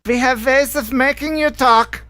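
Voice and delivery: put-on high, hammy German villain accent; a horrible German accent, played up for laughs. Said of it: "it was a horrible German accent".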